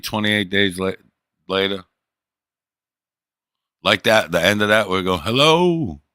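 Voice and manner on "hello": raised, pleading call; first call, already pitched up; desperate hello. Retold or imitated. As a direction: call out a lost, hopeful hello. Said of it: "all desperate 'cause no one else is left".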